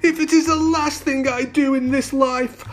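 high, wailing storybook vow; anguished vow. A narrator's reading. Prince swearing to find his wife, high anguished vow. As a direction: keep the high moan while swearing it. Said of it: "he moaned".

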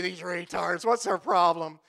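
irritated mock griping; disgruntled worker. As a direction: imitate a worker griping about bosses. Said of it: "And you say".

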